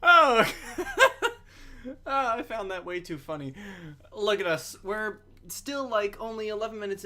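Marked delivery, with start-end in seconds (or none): 0.0-2.0 high, loud fit of laughter; hard laughter. Real, not acted. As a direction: crack up laughing.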